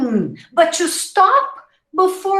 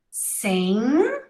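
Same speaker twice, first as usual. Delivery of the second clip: normal talking voice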